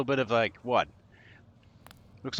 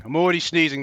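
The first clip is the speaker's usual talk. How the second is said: "already" louder, more worked up; louder repeat; 3 semitones higher, 7 dB louder, faster than usual. Real, not acted. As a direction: say it again with more outrage.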